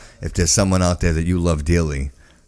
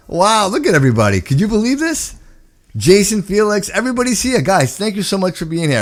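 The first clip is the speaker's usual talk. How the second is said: excited; 11 semitones higher than usual. Real, not acted. Host amazed at viewer count.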